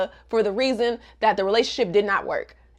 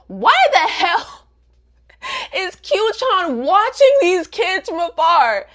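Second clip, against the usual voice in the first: loud, high, drawn-out outrage; outraged disbelief; 8 semitones higher, 4 dB louder, slower than usual. Real, not acted. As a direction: stretch out the indignant question loudly. Outraged aside about a watching hater.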